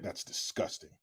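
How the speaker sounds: whispered mock disgust; hushed disgust. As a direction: breathe it out in fake horror.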